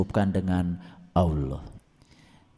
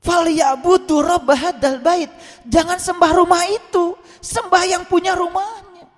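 very high, ringing exhortation; forceful preaching; 5 dB louder than usual. Real, not acted. Preacher exhorting worship of the house's owner, forceful. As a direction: proclaim it at full force.

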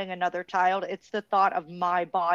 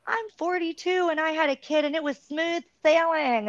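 mocking other moms; 8 semitones higher than usual. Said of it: "people that are like".

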